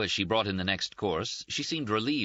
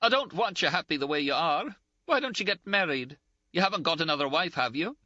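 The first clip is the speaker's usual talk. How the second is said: high-pitched, indignant, close to tears; a reproachful friend near tears, voiced higher; Ferguson's scolding; 12 semitones higher, 3 dB louder than usual. A narrator's reading. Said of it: "Ferguson cried again".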